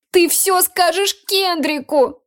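high, slow, tearful accusation; sniffling whine. Acted. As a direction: sniffle through it like a hurt child. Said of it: "шмыгнула носом Дейла".

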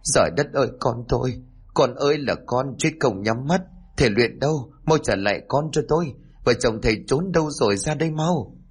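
put-on high keening wail for a dead child. Grieving mother wailing outside for the healer, high lament. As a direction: wail and call him out, voice pitched high. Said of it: "gào hơn".

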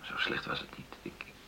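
soft whispered protest; hushed denial. Acted. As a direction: plead gently under the breath. Hushed plea.

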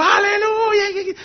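high, drawn-out ecstatic hallelujah; joyful cry. Retold or imitated. Pastor imitating the congregation praising when she danced in. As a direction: cry it out in rapture.

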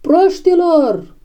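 high, drawn-out cry of exasperation; exasperated shout. A narrator's reading. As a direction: cry out at a foolish crowd. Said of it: "țipă Benjamin". (strigăt exasperat, pe ton înalt)

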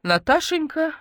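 high, plaintive call by name; lightly voiced doleful comforter; mournful droning. A narrator's reading. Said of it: "заунывно начала Ленка".

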